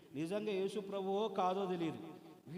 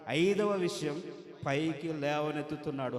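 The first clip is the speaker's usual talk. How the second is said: ordinary talk, nothing marked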